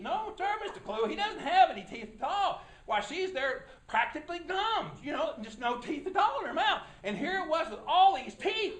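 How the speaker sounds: normal talking voice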